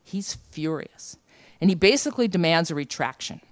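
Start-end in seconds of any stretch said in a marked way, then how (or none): 0.0-1.2 slow, weighty delivery; slow emphasis. Real, not acted. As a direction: lean on it slowly. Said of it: "he is furious".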